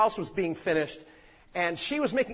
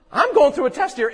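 sudden realization; 9 semitones higher, 9 dB louder than usual. Retold or imitated. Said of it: "She realized".

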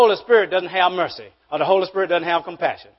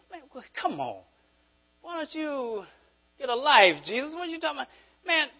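mock skeptic; 9 semitones higher than usual. Voicing a skeptic challenging Jesus.

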